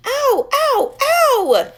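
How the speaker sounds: very high, loud pained scream. A narrator's reading. Chloe after finger stepped on, high loud scream. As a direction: shriek as if badly hurt. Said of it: "she screamed".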